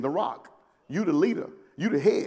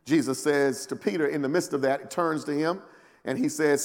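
ordinary delivery

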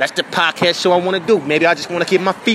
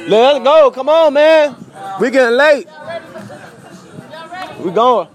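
loud, high call to hurry friends; 11 semitones higher, 4 dB louder, slower than usual. Real, not acted. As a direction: shout to the crew to get moving. Calling friends to head out.

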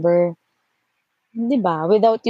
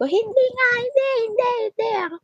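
much higher, frantic mock yell; retold yelling denial; faster than usual. Yelling at the caller that she is not the girl, high mock yell. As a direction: shriek the denial into the phone. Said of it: "Nagsisigaw talaga ako sa phone".

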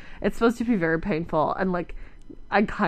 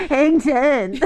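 laughing surfer dude; 6 semitones higher, 6 dB louder than usual. Pretend. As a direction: hang ten like a surfer. Mimicking surfer after voice remark.

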